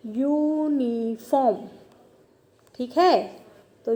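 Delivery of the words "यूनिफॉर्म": यूनिफॉर्म drawn out slowly, syllable by syllable; slow demo word. Shown as a demonstration. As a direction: stretch out यूनिफॉर्म slowly for the class. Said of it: "फिर से एक बार समझा देती हूँ". (यूनिफॉर्म को धीरे-धीरे खींचकर बोलना)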